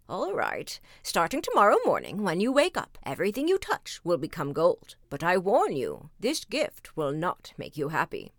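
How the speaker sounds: lightly voiced wizard giving a warning; a hint of an ominous wizard. Wizard grants and warns.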